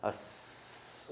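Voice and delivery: long unvoiced hiss like a snake; soft sustained snake hiss. Shown as a demonstration. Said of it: "go on a hiss sound".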